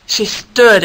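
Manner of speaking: plain reading voice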